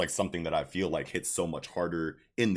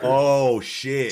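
loud, high, stretched-out oh; real amazement; 7 semitones higher, 9 dB louder than usual. Real, not acted. Co-host learning of the hidden detail, amazed. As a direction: blurt it out as the detail lands.

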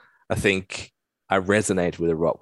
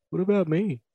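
dog voice; 12 semitones higher than usual. Pretend. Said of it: "walked into the camera like".

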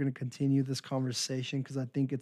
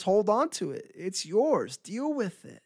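voice of human nature; 7 semitones higher than usual. Retold or imitated. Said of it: "human nature says".

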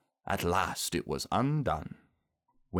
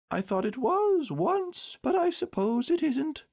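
Pooh. A narrator's reading. Pooh unsure it is his party, high and doleful. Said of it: "said Pooh rather dolefully".

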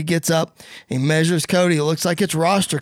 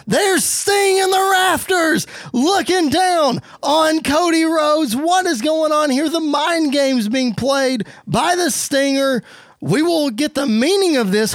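high, urgent wrestling commentary call; stretched-out, high-pitched announcer excitement; excited play-by-play; 9 semitones higher, slower than usual. Acted. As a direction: call it like a shocking reveal at ringside.

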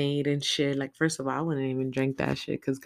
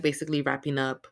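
ordinary delivery

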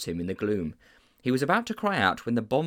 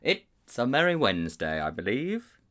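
ordinary delivery, nothing marked

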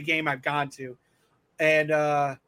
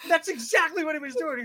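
usual speaking voice

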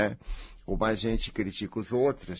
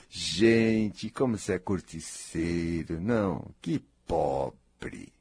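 ordinary delivery

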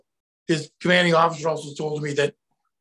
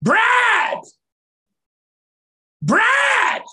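loud high shout across a distance; 6 dB louder than usual. Retold or imitated. Actor on the tank calling the director, loud shout. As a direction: yell for the director far away. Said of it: "I just went".

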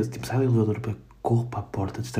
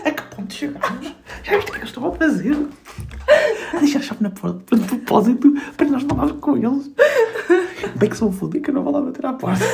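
high, laughing indignant rant; mock outrage. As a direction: ham up the offended neighbour. Mock outrage about neighbours' key.

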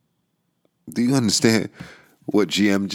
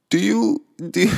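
laughing in disbelief; 12 semitones higher than usual. Real, not acted.

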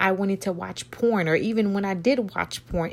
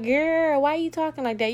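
high-pitched mock listener; puzzled listener; 8 semitones higher than usual. Listener questioning the host's way of talking, mock puzzled. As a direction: put on a higher, questioning girlfriend voice.

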